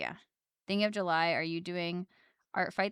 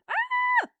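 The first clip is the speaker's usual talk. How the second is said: startled yelp; 7 dB louder than usual. Real, not acted. Sudden startled cry while working.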